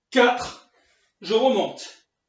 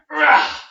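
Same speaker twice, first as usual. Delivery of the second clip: loud, high strained ah; strained effort cry; 5 semitones higher, 9 dB louder than usual. Real, not acted. Coach burning through the last dips, loud strained cry. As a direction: push out the burn with a loud cry.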